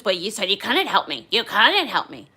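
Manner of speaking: loud, punchy imitation of an indignant passenger; mocking protest. Passenger argues back at the flight attendant, mocking protest. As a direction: throw it back loudly, hammering COULDN'T.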